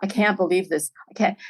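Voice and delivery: gasping and rushed, barely able to get it out; breathless disbelief. Real, not acted. Filmmaker seeing the lineup for her film, breathless. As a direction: pant the words in shock. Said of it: "slightly hyperventilating".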